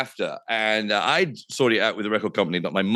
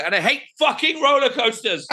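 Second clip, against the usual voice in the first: panicked shout; 13 semitones higher than usual. Retold or imitated. Live on the phone in a launching glider, panicked shout. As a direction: blurt it out as if the glider just launched. Reliving on-air swear in glider.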